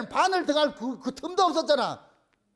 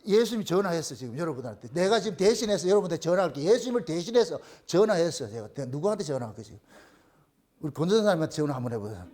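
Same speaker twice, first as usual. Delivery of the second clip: ordinary talk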